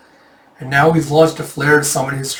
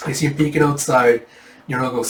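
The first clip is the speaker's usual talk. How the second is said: usual speaking voice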